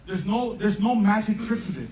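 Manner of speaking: usual speaking voice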